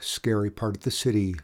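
ordinary delivery, nothing marked

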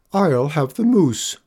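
lightly voiced diner ordering; woman ordering.

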